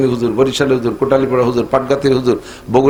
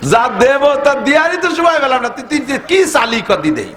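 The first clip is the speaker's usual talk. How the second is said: amused divine voice; 8 semitones higher than usual. Retold or imitated. Voicing God amused.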